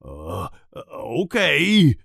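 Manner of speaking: slow, hesitant, unsure okay; worried. Acted. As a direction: drag it out like you're losing confidence. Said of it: "He sounds worried".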